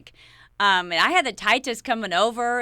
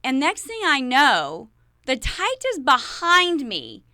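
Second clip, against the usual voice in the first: ordinary delivery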